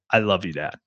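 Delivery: a quiet loving goodbye to dad on the phone; tender sign-off. Retold or imitated. Telling dad love on phone.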